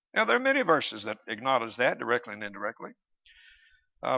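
normal talking voice

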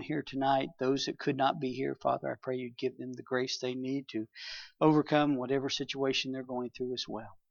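earnest church prayer, fading soft. Acted. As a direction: pray sincerely for the flock. Leads the congregation in prayer.

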